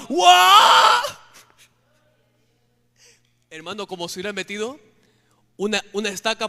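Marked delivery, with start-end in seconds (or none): 0.1-1.0 a sudden very high, very loud howl; demon howl. Retold or imitated. The demon answering the psalm with a shrieking howl. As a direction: howl like a beast struck by the verse. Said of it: "el demonio gritaba".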